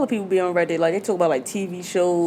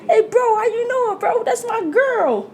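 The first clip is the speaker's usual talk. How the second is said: put-on high-pitched wail of a betrayed boyfriend; mock screaming; 14 semitones higher than usual. Retelling the boyfriend catching his girl in the car, mock screaming. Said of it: "the boyfriend starts screaming like".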